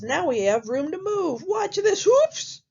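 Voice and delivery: high pig voice climbing to a yelp; second little pig. Acted. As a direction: piglet showing off, pitch rising steeply. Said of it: "And the second pig says".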